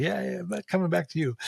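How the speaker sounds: mock a patient who won't return, light and quick; dismissive brush-off. Patient dismissing the doctor's diagnosis, quick mock brush-off.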